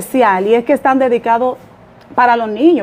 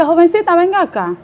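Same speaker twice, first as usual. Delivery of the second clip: calling out; 6 semitones higher than usual. Retold or imitated. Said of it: "y ella me dice".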